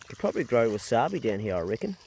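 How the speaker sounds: ordinary delivery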